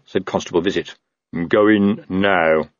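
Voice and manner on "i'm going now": slow, heavy mumble; mumbling troll. Acted. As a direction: drag the words out sullenly. Same mumbling troll constable answers.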